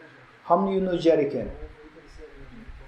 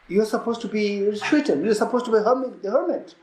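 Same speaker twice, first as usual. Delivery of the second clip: higher-pitched mock reproach; self-scolding; 4 semitones higher than usual. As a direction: lecture yourself as if caught failing. Scolding himself in retold inner dialogue.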